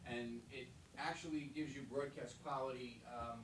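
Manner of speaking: distant, off-mic voice; talking from across the room; far from mic. Real, not acted. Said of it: "I'm just gonna step away from the mic".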